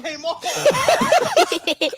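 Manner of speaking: slow words through laughter; laughing. Real, not acted. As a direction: laugh it out, very slowly. Laughing as something falls off.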